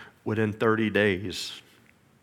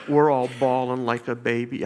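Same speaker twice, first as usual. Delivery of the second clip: ordinary talk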